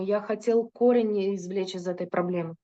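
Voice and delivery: usual speaking voice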